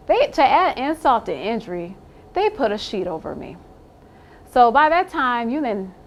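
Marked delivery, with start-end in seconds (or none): none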